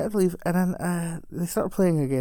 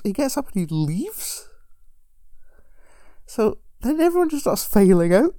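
ordinary talk, nothing marked

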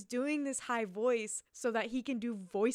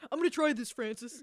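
raised, louder put-on actor voice; mock actor's pitch; 3 semitones higher, 6 dB louder than usual. Imitating the actor telling his director, mock eager. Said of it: "Creative choice".

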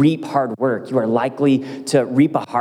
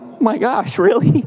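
touched surprise; 10 semitones higher than usual. Retold or imitated. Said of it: "And I'm like".

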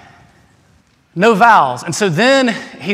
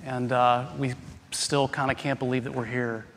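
soft, unhurried spoken prayer; hushed pastor's prayer; 3 semitones lower, 5 dB quieter than usual. Real, not acted. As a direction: pray quietly and earnestly before the sermon. Pastor's opening prayer, quiet and slow.

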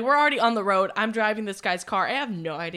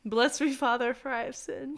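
mock penitent; 2 semitones higher than usual. Storyteller confesses to friend as priest, mock penitent. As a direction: recite the opening of a pretend confession.

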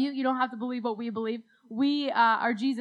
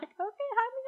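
much higher, softer sweet mother voice; sweet mom; 11 semitones higher, 6 dB quieter than usual. Retold or imitated. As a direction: gently imitate a calm mom. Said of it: "so sweet".

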